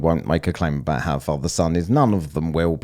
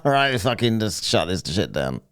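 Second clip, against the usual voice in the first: mock annoyed impression; 5 semitones higher than usual. S0 mocking the others' outrage over Shiva, squeaky and high. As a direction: mimic his outrage in a silly high voice. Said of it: "They're pretending that he's annoyed".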